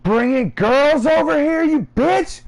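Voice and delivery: high, loud, drawn-out mock scolding; angry mom impression. Friend imitates Mike's mom confronting Twig, hammed-up fury. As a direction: exaggerate her furious high-pitched yell.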